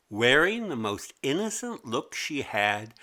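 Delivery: usual speaking voice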